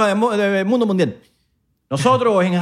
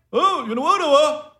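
high, mocking "I'm not recording"; teasing a friend who won't record, mock whine; mock whiny friend; 10 semitones higher than usual. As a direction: parody his excuse in a whiny voice.

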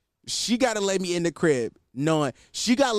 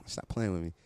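sexy voice; 7 semitones lower, 8 dB quieter than usual. Shown as a demonstration. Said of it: "I put the sexy voice on".

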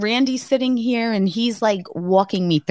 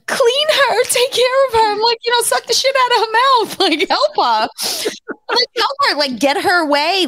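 high urgent pleading. Retold or imitated. New mother begging nurses to clear baby's mouth, high and urgent. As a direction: plead with the nurses to help her. Telling nurses to help baby.

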